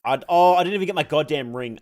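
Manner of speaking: ordinary delivery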